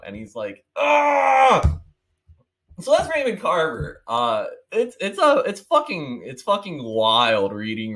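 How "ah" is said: high, loud mock shriek of outrage; a hammed-up frustrated yelp; sudden high exasperated yell; outraged cry. Man's outraged reaction.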